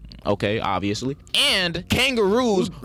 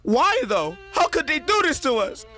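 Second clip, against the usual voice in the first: mock outrage; 10 semitones higher than usual. Mock outrage over chicken law.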